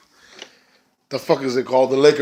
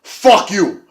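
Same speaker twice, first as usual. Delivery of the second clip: higher, louder snarl; mock defiance; 8 semitones higher, 4 dB louder than usual. Gail Kim glaring at Maria, higher defiant snap. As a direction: spit it at her. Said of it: "she looks at her like".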